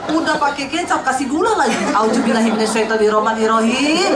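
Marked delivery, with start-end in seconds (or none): 1.9-4.1 mock horror. Reacting to sweet cooking.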